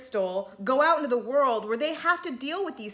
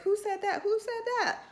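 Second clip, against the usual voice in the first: much higher, shocked dramatic demand; Housewives drama; 10 semitones higher, 3 dB quieter than usual. Retold or imitated. Host recalls Atlanta housewives at the table, high dramatic outrage. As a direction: camp reality-show outrage, rising higher. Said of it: "Real Housewives of Atlanta".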